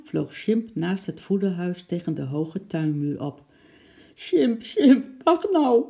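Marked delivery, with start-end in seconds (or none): 4.1-5.8 high, loud calling on the edge of tears; a girl calling her monkey, half in tears; half crying call. A narrator's reading. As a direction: cry out after him, nearly sobbing. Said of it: "riep Lotje half huilend".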